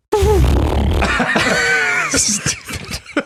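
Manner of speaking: high shrill whinny; whinny like a horse. Pretend. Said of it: "A whinny".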